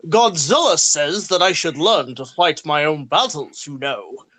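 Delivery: lightly voiced grand Shakespearean actor; a hint of a stage-actor Charles Dance reading. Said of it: "saying in a very Shakespearean voice".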